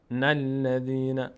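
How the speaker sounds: "الذین" said slowly as na-lladhina; linked nun. Shown as a demonstration. Teacher repeats the join for learners, linked nun. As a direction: join an N onto the front of "الذین". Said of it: "اینجا میچسبه نون به".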